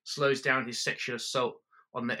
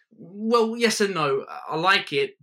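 high, loud flustered backpedal; flustered protest; 8 semitones higher, 8 dB louder, slower than usual. Retold or imitated. You justifying yourself to partner, loud and high. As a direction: blurt it out high and defensive.

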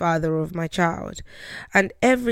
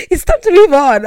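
much higher, loud mock taunt; 10 semitones higher, 14 dB louder than usual. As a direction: parrot him mockingly, very high. Mocking his words, loud.

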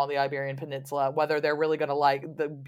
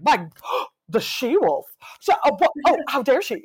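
mock outrage; 9 semitones higher, 7 dB louder than usual. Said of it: "it was like".

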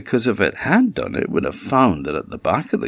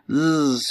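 imitated buzz, pitched up; bumblebee buzz; 8 semitones higher than usual. Bee flies into the cave, buzzing. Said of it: "so the bee buzzed in the cave".

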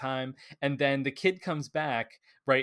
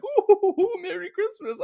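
much higher, loud squealing Scrooge; giddy Scrooge; 8 dB louder, slower than usual. Retold or imitated. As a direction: squeal it with glee. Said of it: "he's like".

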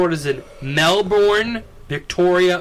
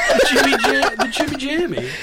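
laughing; 7 semitones higher than usual. Real, not acted. Joking made-up trophy name.

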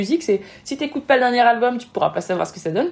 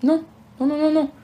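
higher-pitched run of quick no's; emphatic objection; 6 semitones higher than usual. Acted. Coach talking a hesitant creator out of deleting, emphatic objection. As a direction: wave the idea away with repeated no's. Objecting to imagined self-censoring.

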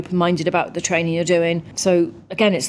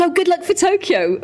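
much higher, cheery mock well-wisher; cheery fan; 13 semitones higher than usual. Stranger wishing the retired athlete luck, high and cheery. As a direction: sing it out like a chirpy fan.

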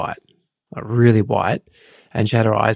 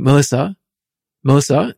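firm, higher and a bit louder calling of her name; strong paramedic voice; 4 semitones higher, 4 dB louder than usual. Retold or imitated. Paramedic calling the patient's name, firm and strong. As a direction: call her name like a paramedic taking charge. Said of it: "very strong voice".

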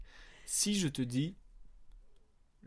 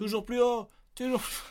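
high, loud game show chant; Fort Boyard slogan; 10 semitones higher, 5 dB louder than usual. Retold or imitated. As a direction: belt it like the Fort Boyard host. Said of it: "Fort Boyard".

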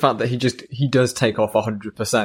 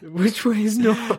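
Luffy impression; 10 semitones higher, slower than usual. Cohost joins Luffy impression.

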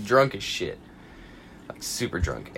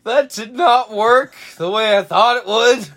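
higher, laughing dazed voice from the floor; dazed drunk friend; 9 semitones higher, 6 dB louder than usual. Retold or imitated. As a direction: mumble it after a faceplant. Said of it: "all you hear from the floor".

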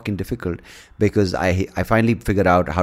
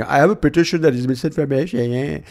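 mocking whiny voice, pitched a bit higher; whiny complainer; 7 semitones higher than usual. Mocking critics of the podcast host, a whiny mock voice. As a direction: mimic a petty whiner. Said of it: "stop whining like a little bitch going".

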